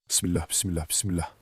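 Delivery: low murmured bismillah; murmured prayer. Real, not acted. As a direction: mutter a nervous prayer to yourself. Nervous prayer under the breath.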